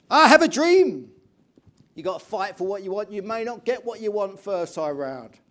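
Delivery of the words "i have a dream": very loud, high, drawn-out proclamation; booming oratory. Acted. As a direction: thunder it out like a great orator. Breaks into famous speech quote.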